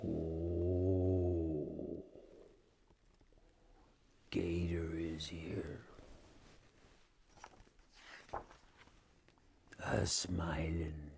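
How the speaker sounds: low, slow whispered dread; creepy low whisper; ominous whisper. A narrator's reading. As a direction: hush it like danger is near. Ominous arrival of the gator.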